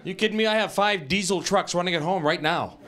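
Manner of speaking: ordinary talk, nothing marked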